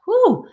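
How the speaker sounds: high breathless woo; recovering gasp. Real, not acted. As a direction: let out a breath after choking. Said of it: "it went down the wrong way".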